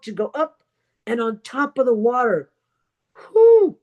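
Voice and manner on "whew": high, loud "whew" of relief; sharp high exhale of relief; relieved whew. Acted. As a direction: let out a big acted whew. Relief after surfacing in raft story.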